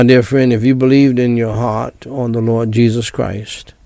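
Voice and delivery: ordinary delivery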